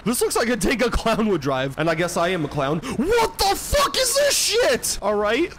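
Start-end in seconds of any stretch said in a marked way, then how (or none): 2.8-5.0 shocked disbelief. Real, not acted. Cars keep spawning.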